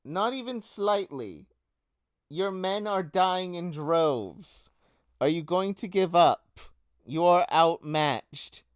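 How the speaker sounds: high-pitched, commanding battlefield call; defiant enemy leader. Acted. She answers the Rat King mid-battle, defiant. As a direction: call out scornfully to a losing foe. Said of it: "She goes".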